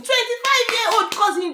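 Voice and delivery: high-pitched, disbelieving repeat; incredulous echo. Real, not acted. Host challenges the man's claim about his cousin, incredulous. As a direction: throw the words back in disbelief.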